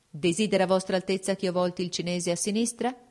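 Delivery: ordinary delivery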